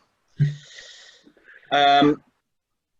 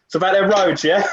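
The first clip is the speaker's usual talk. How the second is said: sly matey nudge, pitched up; nudging mate voice; 9 semitones higher, 3 dB louder than usual. Retold or imitated. GM voicing Rold nudging strangers, sly and matey. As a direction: lean in like a knowing local. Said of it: "Rold's just nudging people going".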